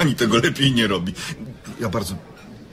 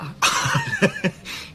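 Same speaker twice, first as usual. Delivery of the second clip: high, relieved burst of laughter; 4 dB quieter than usual. Acted. A man laughing with relief at the mix-up.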